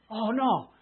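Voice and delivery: mock dismay. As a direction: imitate someone discovering a disaster. Acts out man's dismay at disaster.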